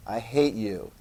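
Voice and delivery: low, softened mock hatred; quiet resentment. French people answering their king, quiet resentment. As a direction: play resentment low and under your breath.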